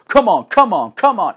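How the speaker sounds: hammed-up pleading to leave; mock urging shout. Boyfriend urges her to leave.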